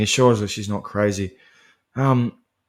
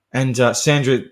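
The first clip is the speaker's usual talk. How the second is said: usual speaking voice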